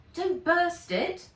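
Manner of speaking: high, loud, slow worried plea; anxious warning. A narrator's reading. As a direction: warn him urgently. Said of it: "she said".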